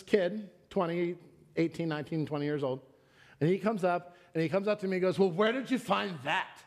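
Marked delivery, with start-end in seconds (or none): 5.2-6.6 higher, mock-challenging question; exaggerated questioning of a customer; imitating young clerk. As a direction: mimic the kid's challenging question, pitched up. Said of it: "That's how he said it".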